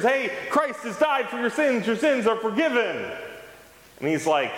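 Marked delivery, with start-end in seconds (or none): 0.0-3.1 much higher, bright announcing of good news; joyful proclamation. Pretend. A pastor proclaiming forgiveness to sinners, much higher and joyful. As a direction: proclaim it with delight.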